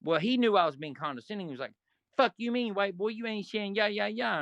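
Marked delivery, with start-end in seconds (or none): 2.2-4.4 higher, mocking tough-guy retort; a lightly voiced hothead snapping back; angry retort. Bull retorting angrily.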